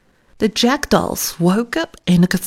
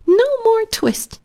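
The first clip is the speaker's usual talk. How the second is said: tiny mouse voices, much higher, shouted; twittering mouse shout. A narrator's reading. Little mice shouting at Simpkin through the window, high squeaky cry. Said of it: "shout at once in little twittering voices".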